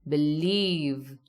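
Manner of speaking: "Believe" with a long, weighted "lieve"; stress on second syllable. Shown as a demonstration. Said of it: "The stress here is on leave".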